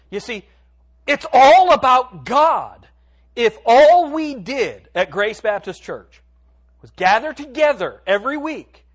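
loud, high, emphatic sermon delivery; booming, drawn-out preacher's proclamation; full-force preaching. Real, not acted. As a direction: proclaim it to the whole congregation, stretching the key words.

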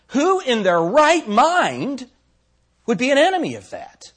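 preacher's incredulous pulpit cry; rhetorical challenge. Acted. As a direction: demand it of the whole room.

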